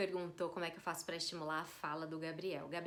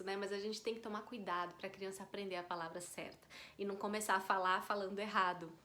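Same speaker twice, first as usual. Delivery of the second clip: usual speaking voice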